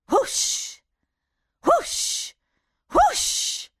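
breathy rising whoosh noise; magic gust sound effect; whooshing wind. A narrator's reading.

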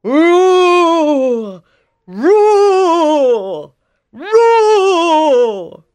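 loud roaring cries; trapped lion roars. Acted. Lion caught in a trap roars for help. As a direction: roar out in distress. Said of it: "he hears a roar".

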